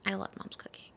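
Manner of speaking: very soft, whispered declaration; hushed aside. Real, not acted. Sister placating Mom after criticism of her cooking, whispered. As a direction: say it under your breath, almost all whisper.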